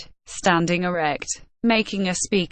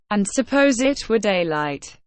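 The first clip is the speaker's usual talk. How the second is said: ordinary delivery, nothing marked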